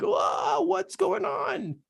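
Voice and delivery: whispered, high and slow disbelief; breathless whispered amazement at the rush of clients; stunned whoa. Retold or imitated. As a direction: let the surprise steal your voice. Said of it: "So I'm like".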